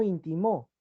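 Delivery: "íntimo" pronounced in-ti-MÓ; stress on last syllable. Shown as a demonstration. Teacher giving students another triple, "íntimo" stressed on MÓ as in-ti-MÓ. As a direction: throw the stress of "íntimo" onto the final MÓ. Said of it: "Cambia, igual".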